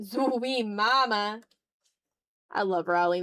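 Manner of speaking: ordinary talk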